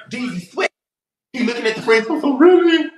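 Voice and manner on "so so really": high, indignant voice of a betrayed guy; high whiny upset guy. Retold or imitated. Mimicking the guy complaining his friends turned on him, high and indignant. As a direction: squeal it like he can't believe it.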